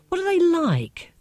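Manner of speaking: lightly voiced curious girl; Marcia asking. A narrator's reading. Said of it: "she asked".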